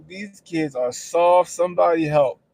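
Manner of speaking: loud, slow mock plea for help; exasperated plea. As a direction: drag out like it's hopeless. Exasperated quote about kids.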